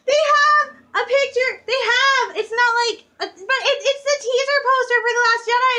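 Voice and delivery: high, loud fangirl outburst; so excited. Retold or imitated. Fan telling viewers about the poster she found, very excited. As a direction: gush breathlessly high and loud, tripping over the words. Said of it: "I got so excited".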